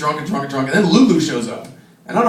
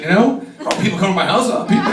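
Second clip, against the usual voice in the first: ordinary talk, nothing marked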